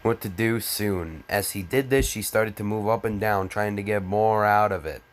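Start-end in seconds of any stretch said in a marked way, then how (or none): none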